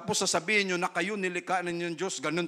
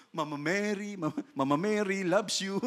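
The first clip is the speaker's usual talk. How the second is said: mock devotee; 4 dB quieter than usual. Mocking Marian devotion sing-song.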